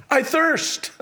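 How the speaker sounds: lifted high and strained; a thirsty cry lifted high. Acted. Preacher acts Christ's words.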